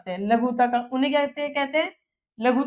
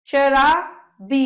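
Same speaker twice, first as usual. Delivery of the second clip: drawn out slowly; 3 dB louder, much slower than usual. Shown as a demonstration. Teacher dictating words to class.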